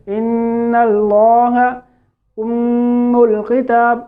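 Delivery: slow recitation, long nasal hum on the doubled n of ان and m of ام; ghunna. Shown as a demonstration. Teacher showing where ghunna belongs, held nasal hum. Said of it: "اس نون کے اوپر ہم گنہ کریں گے".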